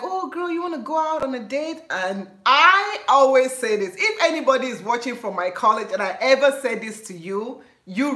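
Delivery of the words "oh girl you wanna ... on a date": high, coaxing ask; imitating a suitor. College guy asking her on a date, high and coaxing. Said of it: "then they're like".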